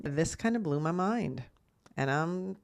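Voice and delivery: ordinary talk, nothing marked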